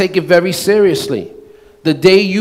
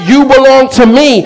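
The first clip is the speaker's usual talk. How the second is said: higher and louder, full of boldness; bold declaration; 6 semitones higher, 5 dB louder than usual. Retold or imitated. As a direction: proclaim it with total confidence. God declaring boldly to the listener.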